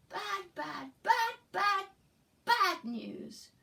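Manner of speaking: loud, high angry shout; loud yelling. Acted. Teacher rereads the page, shouting as the angry character. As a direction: yell it in a rage. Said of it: "He's yelling it really loud".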